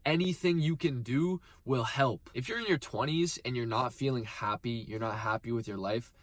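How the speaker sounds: usual speaking voice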